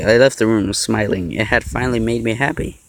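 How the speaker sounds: ordinary delivery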